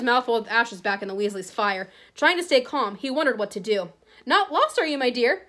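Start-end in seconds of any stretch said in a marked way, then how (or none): 4.2-5.4 higher-pitched wheedling witch; old witch. Acted. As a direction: creep up with a sugary question.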